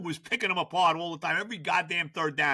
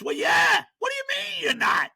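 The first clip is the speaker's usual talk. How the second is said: much higher, louder, outraged yell; incredulous shout; 10 semitones higher, 4 dB louder than usual. Real, not acted. Host yells back at the coach's words, outraged. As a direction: blow up at the claim.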